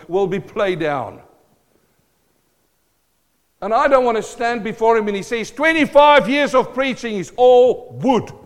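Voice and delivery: loud, high cries from the pulpit; impassioned preaching. Real, not acted. Preacher fears God judging his years of sermons, impassioned preaching. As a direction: build to short, ringing outcries.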